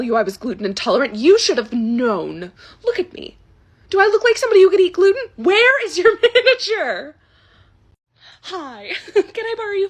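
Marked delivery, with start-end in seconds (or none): 2.8-7.2 louder, higher, fast outraged demand; demanding manager. Acted. Karen demanding the restaurant manager, loud and outraged. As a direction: hit the manager demand hard.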